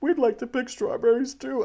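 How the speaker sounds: high, sing-song teasing voices; mock chorus. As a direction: chime in like cheeky kids. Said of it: "And they went".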